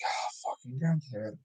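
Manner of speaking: very soft, low exasperated grumble; deflated curse. Retold or imitated. Realising the pizza order was a prank, deflated curse. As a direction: swear quietly, all energy gone.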